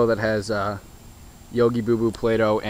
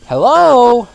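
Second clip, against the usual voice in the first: calling out; 13 semitones higher, 14 dB louder than usual. Real, not acted. Calling out to the chickens.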